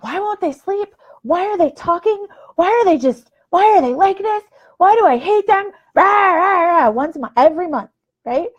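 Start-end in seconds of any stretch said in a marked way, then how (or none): 0.0-5.7 high, desperate whine of an exhausted mom; whiny frazzled mom. Retold or imitated. Tired mom posting on Facebook about sleepless kids, high desperate whine. 5.9-6.9 mock wailing. Mock crying rant.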